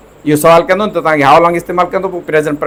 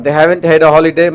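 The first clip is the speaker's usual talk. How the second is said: usual speaking voice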